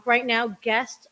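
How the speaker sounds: normal talking voice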